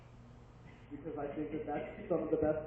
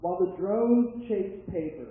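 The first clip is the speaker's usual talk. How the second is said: raised, loud declaimed verse; poem recitation; 3 semitones higher, 9 dB louder than usual. Acted. Poet begins Untitled Future Poem number one, declaimed recitation. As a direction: proclaim the opening line to the room.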